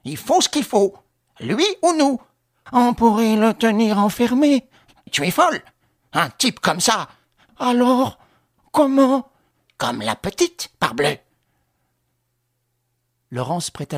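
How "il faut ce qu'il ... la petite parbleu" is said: high, loud plotting voices; tense villains' exchange, high and forceful; conspirator. Acted. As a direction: argue it out in a raised, pitched-up voice.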